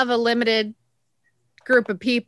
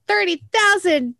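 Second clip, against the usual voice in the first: mock ad voice; 8 semitones higher than usual. Quoting website slogan, mock hype.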